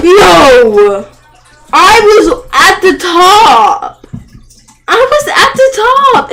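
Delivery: very loud, high, slow wail of loss; anguished yell. Real, not acted. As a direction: cry out that you were at the top. Falls from the top in game.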